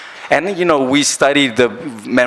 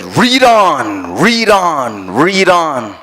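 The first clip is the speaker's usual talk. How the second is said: raised, ringing call, repeated three times; loud blessing; 4 semitones higher, 3 dB louder, much slower than usual. Retold or imitated. Guru blessing the departing devotee, loud and ringing. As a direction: boom it out like a blessing. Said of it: "said three times in a very loud voice".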